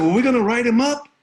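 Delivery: high, loud, fast demand; eager insistence. Retold or imitated. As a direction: push hard for the write-up. Boy eager to punish bully.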